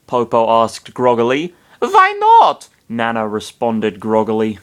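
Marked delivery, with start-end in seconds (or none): 1.8-2.7 put-on high squeaky child voice; squeaky little girl voice.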